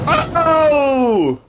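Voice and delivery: high, stretched "uh-oh"; mock-alarm shout. Streamer anticipating the trailer's explosion, mock-alarm shout. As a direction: call it out high and long.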